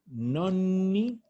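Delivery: Nonni with a lingering NN; slow Nonni, stretching the middle consonant; long double N. Shown as a demonstration. Teacher contrasting grandparents with ninths, long double N in Nonni. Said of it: "Con una N es otra cosa".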